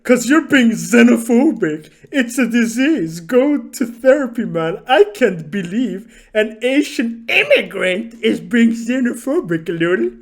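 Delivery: imitating his outraged message, loud and high; angry accusation. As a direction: throw the accusation in a hammed-up shout. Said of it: "He's like".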